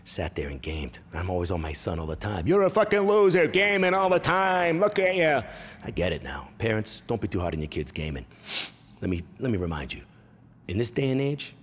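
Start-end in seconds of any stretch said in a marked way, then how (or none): 2.5-5.4 high, loud put-on tirade; yelling angry father. Father berating son for gaming, loud high mock rage.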